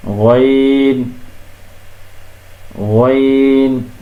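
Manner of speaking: গইন drawn out very slowly from the throat; গইন very slow, throaty; Arabic ghain. Shown as a demonstration. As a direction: stretch গইন long. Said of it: "এরপরে".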